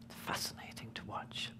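soft awed whisper. Real, not acted. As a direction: breathe it out in wonder. Marvelling at baby's discovery.

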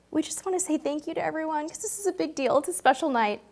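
grateful and near tears; emotional. Real, not acted. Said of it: "I knew I was gonna get emotional".

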